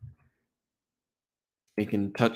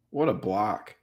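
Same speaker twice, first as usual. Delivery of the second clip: ordinary talk, nothing marked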